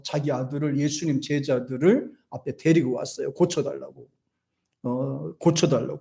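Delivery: ordinary delivery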